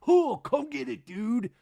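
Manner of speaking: high, slow, loud taunt; mock goading. Zach giving looks and goading, high mock taunt. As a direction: dare him with hammed-up bravado.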